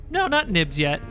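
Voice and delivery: ordinary delivery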